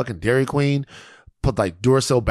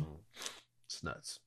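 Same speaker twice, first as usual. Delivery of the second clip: whispered; 6 semitones lower, 25 dB quieter than usual. Real, not acted. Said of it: "repeated, first whispered".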